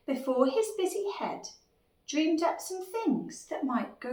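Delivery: lively, lilting picture-book read; children's story narration. A narrator's reading. Narrator reads a worried dinosaur's tale to children, storytelling. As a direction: read it to small children with big swoops of pitch.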